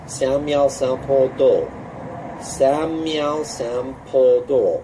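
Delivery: slow, deliberate Samyao Sampo Do; teacher modelling Samyao Sampo Do slowly; slow syllable demo. Shown as a demonstration. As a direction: drag each syllable out. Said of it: "syllable by syllable".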